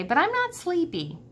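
not sleepy pitched high and pressing; insistent. Acted. Ivan telling Stella he can't sleep, high insistent protest. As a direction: lift the voice, insist on it. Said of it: "I'm tired, I say".

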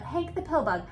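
ordinary delivery

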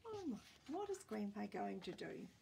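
usual speaking voice

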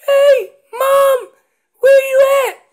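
very high, loud distant call to Mom; lost calf calling. Acted. Calf strayed from Mom, calling out high and loud. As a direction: holler up high across a distance. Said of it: "ventured off a little further".

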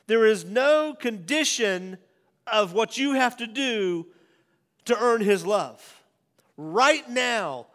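loud, high, slow emphatic preaching. Real, not acted. Pastor preaching unconditional love with full force. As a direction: drive each phrase home.

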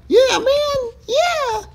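very high falsetto cheer; fake enthusiastic agreement. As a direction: squeal agreement to win them over. Fake agreement before double cross.

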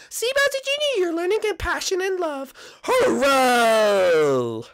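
high, slow praising mother voice; proud mom. Acted. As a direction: praise your son warmly, slowly.

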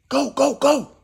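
high, loud go go go; urgent shout. Retold or imitated. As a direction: yell it in panic. Shouting during the attack.

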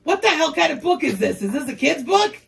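ordinary delivery

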